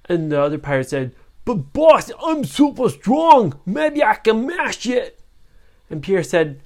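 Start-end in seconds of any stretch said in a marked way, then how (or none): 1.4-5.2 high, loud, slow brute voice; boastful strong henchman. Acted. Strong pirate brags to his captain, loud high voice. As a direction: puff up like a proud muscleman.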